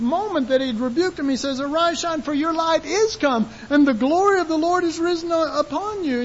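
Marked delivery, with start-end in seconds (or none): 1.6-6.2 high, proclaiming call; God's blessing. Shown as a demonstration. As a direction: lift the promise over them. Voicing God's blessing, other side.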